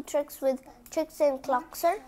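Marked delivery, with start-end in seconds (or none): none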